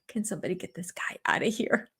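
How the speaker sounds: whispered, fast and faint; mock whisper. Imitating an annoyed man whispering for help. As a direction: hiss it under the breath, fed up. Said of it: "half of it is like".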